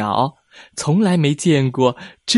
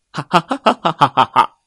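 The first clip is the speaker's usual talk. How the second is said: mocking laugh; 4 semitones higher, 4 dB louder, faster than usual. Whale mocks bird.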